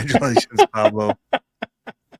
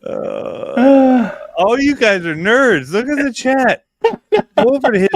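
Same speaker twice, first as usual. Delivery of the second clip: teasing shout; 12 semitones higher, 5 dB louder, slower than usual. Real, not acted.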